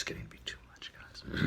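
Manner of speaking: soft confiding whisper; whispered aside. Real, not acted. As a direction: lean in and whisper it to the crowd. Comic aside to the audience.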